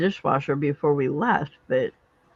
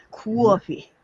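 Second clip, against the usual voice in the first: put-on Boston accent; 4 semitones higher than usual. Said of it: "Boston accent's a little bit different".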